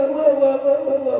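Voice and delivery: high imitated sobbing wails; mock wailing. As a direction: wail like the weeping man.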